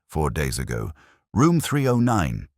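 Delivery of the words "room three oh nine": ordinary delivery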